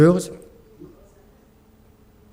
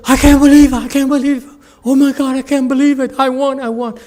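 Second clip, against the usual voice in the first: high, loud disbelieving joy; squealing with delight at the win; overjoyed winner; 12 semitones higher, 6 dB louder, faster than usual. Retold or imitated. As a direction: shout it like you just won the prize. Winner overjoyed after victory.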